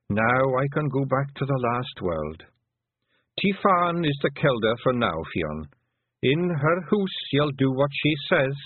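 put-on an old clan mother talking fondly of her lads; old Pictsie mother.

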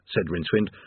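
usual speaking voice

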